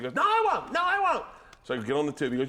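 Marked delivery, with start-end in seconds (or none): 0.1-1.2 loud, very high protest; mock insistent denial. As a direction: imitate a stubborn friend yelling back. Friend protests he won't ask.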